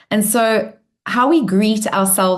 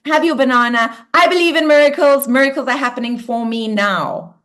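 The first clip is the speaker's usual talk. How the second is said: firm, bold reply; 3 semitones higher than usual. Shown as a demonstration. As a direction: shoo the monkey mind off with conviction. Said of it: "you go".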